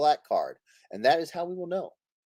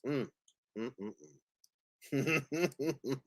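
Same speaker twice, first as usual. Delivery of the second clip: normal talking voice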